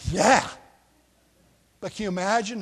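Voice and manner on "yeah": delighted groom. Retold or imitated. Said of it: "they're like".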